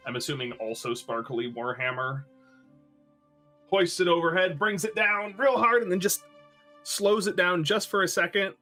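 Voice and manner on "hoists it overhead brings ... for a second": higher, louder narration of the swing; building suspense. A narrator's reading. Game master narrates the warhammer coming down, raised dramatic build. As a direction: build to the big blow.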